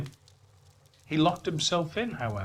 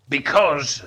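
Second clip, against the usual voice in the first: defensive retort; 8 dB louder than usual. Acted. Scrooge starts his excuse.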